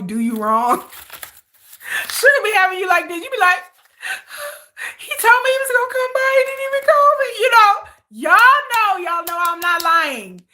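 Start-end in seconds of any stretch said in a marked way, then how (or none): 5.0-7.3 higher, louder pouting complaint; whiny girlfriend. Retold or imitated. Girlfriend whining to fans that [name] never called. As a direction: play the hurt girlfriend complaining.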